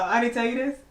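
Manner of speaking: usual speaking voice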